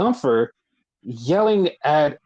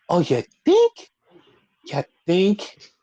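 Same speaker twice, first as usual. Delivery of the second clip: higher, rising, drawn-out sarcasm; pointedly obvious question, lifted at the end; sarcastic mock realization; 3 semitones higher, slower than usual. Podcaster mocking fans who caught on late, sarcastic.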